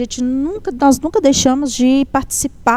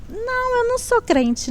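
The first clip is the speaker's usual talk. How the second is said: high, soft imitated protest; softly disclaim it in a raised pitch; retold denial; 11 semitones higher, 5 dB quieter than usual. Nurse answers which church she attends, high soft denial.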